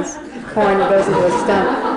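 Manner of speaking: loud, laughing through the sentence; laughing while talking. Real, not acted. Storyteller telling of the rhino's horn in a stump, laughing. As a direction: crack up as you tell it.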